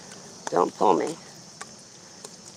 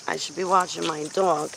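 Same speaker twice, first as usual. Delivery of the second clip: ordinary delivery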